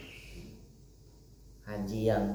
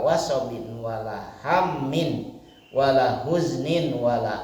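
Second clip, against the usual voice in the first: slow, louder chanted Arabic recitation; hadith recitation; 5 dB louder, much slower than usual. Acted. Preacher recites the hadith to his congregation, slow solemn chant. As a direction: intone the hadith slowly and fervently.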